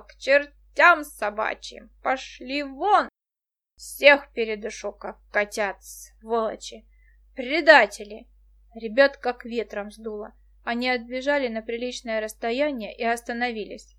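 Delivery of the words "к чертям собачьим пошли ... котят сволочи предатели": put-on drunken angry shout. A narrator's reading. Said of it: "закричал".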